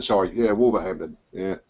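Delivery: ordinary talk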